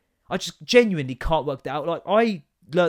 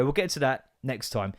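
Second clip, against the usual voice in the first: ordinary talk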